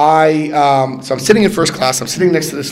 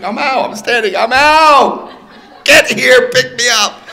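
high, loud, laughing joy; bursting with giddy relief; laughing elated call; excited; 13 semitones higher, 11 dB louder than usual. Retold or imitated.

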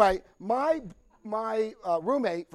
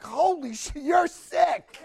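high, loud laughing exclamation; laughing shock; 10 semitones higher, 9 dB louder, much slower than usual. Retold or imitated. Reacting to sponsor's story.

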